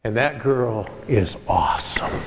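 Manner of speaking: hushed whisper. Real, not acted. Preacher whispering proudly to the congregation about his granddaughter. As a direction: breathe it out, hushed and slow.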